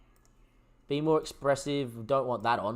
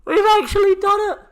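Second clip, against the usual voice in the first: screamed much higher and louder, pure joy; ecstatic screaming; 11 dB louder than usual. Real, not acted. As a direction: scream the victory at the top of your lungs. Still celebrating the win.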